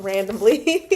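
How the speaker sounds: last word dissolves into a high, loud laugh; breaking into laughter. Real, not acted. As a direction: crack up as the word ends. Amused by a random thought.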